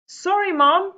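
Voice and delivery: put-on high, loud kids' apology; sheepish boys. Boys apologising to Mom, high guilty kid voices. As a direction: squeak out a guilty sorry. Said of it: "said the boys".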